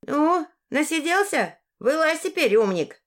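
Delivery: put-on high-pitched call of an old woman; mildly loud call. A narrator's reading. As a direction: call him out, pitched well up. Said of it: "громко сказала".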